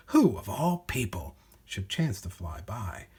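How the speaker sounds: usual speaking voice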